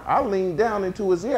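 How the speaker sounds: normal talking voice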